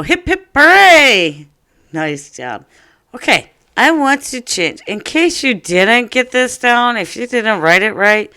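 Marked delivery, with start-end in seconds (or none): 0.0-1.5 cheer. Real, not acted. Cheering the class. 3.1-8.3 bright teacher energy. Acted.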